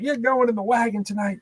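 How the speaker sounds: high-pitched mock threat without extra volume; hammed-up arresting officer; imitated cop threat. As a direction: taunt as a worked-up officer.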